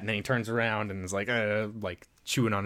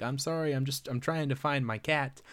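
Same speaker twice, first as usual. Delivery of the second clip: put-on little girl; 5 semitones higher, 4 dB quieter than usual. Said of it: "she's like".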